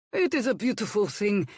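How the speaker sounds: put-on a touch of pleased accented cook; happy cook. A narrator's reading. Hercules praising Violet's potato, lightly happy. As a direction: admire the potato happily. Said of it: "he pronounced happily".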